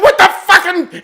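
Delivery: high indignant burst; mock outrage. Dad on the stairs seeing his son, mock outrage. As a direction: imitate a baffled outburst. Dad's outburst retold.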